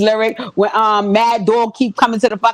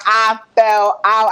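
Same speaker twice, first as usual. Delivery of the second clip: normal talking voice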